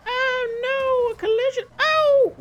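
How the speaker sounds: high panicked yelps at a near crash; startled cry. Real, not acted. Driver nearly hit by a speeder, yelping in high loud alarm.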